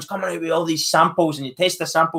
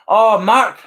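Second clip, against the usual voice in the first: delighted surprise; 6 semitones higher, 6 dB louder than usual. Real, not acted. Surprised by a big donation.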